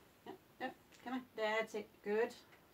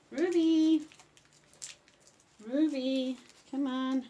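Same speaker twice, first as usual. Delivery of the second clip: calling out; 3 semitones higher, 11 dB louder, slower than usual. Real, not acted. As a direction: call the dog across the room. Calling dog's name.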